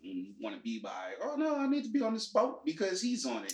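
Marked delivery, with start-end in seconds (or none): 1.2-3.5 mocking girly voice. Mocking the girl character.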